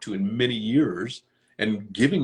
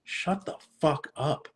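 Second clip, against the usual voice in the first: higher, slow snap at himself; a mock stern inner voice cutting off his complaining; self-scolding; 7 semitones higher, slower than usual. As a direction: tell your own whining to stop. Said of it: "I'm like".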